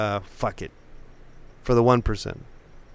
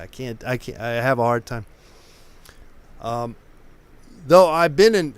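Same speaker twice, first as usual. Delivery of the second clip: ordinary talk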